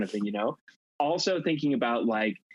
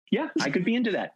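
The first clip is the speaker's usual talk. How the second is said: higher, easygoing voice of a guy up for anything; eager guy; 10 semitones higher, faster than usual. Retold or imitated. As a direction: play a keen boyfriend saying sure, why not. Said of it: "a guy would be like".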